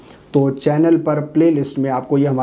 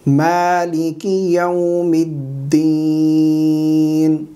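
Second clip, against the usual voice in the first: very slow Quranic recitation with long-held vowels; correct recitation; much slower than usual. Shown as a demonstration. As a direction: recite it syllable by syllable, drawing out every long vowel. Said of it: "صحیح تلفظات کے ساتھ پڑھ کر سکھائیں گے".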